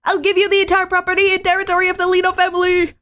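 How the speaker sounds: put-on very high, fast desperate anime girl. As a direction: blurt it out in a high rush. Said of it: "anime enough".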